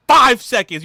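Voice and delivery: high, very loud burst; emphatic shout. Real, not acted. Warning buyers how fast preorders go, emphatic shout. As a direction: shout FIVE out high and hard.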